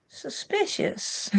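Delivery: slow, breathy whisper of mock suspicion; a hushed, knowing aside, slowly. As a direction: drop to a slow conspiratorial whisper, drawing the word out. Mock suspicion at test name.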